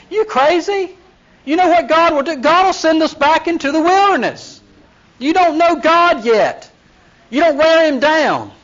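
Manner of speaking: high-pitched exasperated scolding; retold Moses outburst. Moses scolding Reuben and Gad leaders, high exasperated outburst. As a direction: let him blow up at the two tribes. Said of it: "How do you expect Moses to react?".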